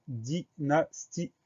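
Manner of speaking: "dynastie" slowly sounded out in separate syllables; slow dictation of "dynastie". Shown as a demonstration. Teacher sounds out new word.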